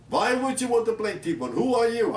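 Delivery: high-pitched mock indignation with mimicked accent; Otto Preminger. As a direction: imitate an affronted director grilling a nobody. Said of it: "Otto Preminger with his accent".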